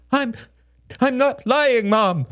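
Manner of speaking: put-on very high, stammered child's protest; squeaky, tearful denial; crying little boy. A narrator's reading. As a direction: plead innocence like a wronged small boy. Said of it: "cried Samuel".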